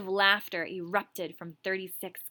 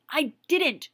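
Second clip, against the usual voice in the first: protesting; 6 semitones higher, 6 dB louder than usual. Acted. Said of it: "Gregory protested".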